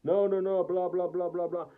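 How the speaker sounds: dismissive mock of the attorney's excuses, trailing into blah blah; lightly voiced sneering lawyer. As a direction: brush it off the way he did, sarcastically. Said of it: "He told me".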